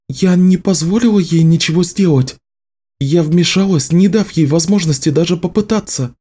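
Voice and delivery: a firm female character; Nicci's voice. Acted.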